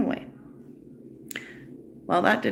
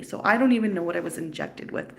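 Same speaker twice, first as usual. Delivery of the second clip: usual speaking voice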